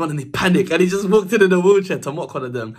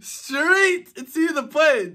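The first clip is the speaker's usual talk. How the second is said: a laugh then the line drawn out very slow and higher; 9 semitones higher, much slower than usual. Real, not acted. Repeating the lyric to the viewers, drawn-out laughing. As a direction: savour every word, cracking up.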